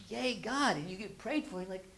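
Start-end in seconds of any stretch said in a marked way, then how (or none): 0.1-0.8 high, bright little cheer of praise; mock cheer. Churchgoer after prayer, mock excited cheer.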